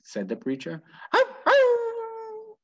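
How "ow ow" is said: high, crying double howl; wailing dog. Acted. Winn-Dixie wailing outside during the sermon, high crying howls. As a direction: wail it like a lonely dog. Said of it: "wailed Winn-Dixie".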